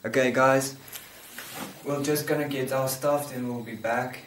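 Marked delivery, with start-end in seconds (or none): none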